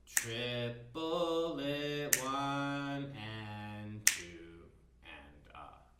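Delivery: triplet, one and two and up, stretched very slowly; slow counting. Shown as a demonstration. Teacher counts the half note pulse slowly. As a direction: drag the count out evenly. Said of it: "think of the half note pulse".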